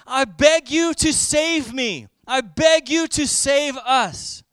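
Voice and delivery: slow, loud, deliberate plea with BEG leaned on; slow pleading. Shown as a demonstration. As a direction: draw out each word like a desperate request. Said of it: "literally hosanna means".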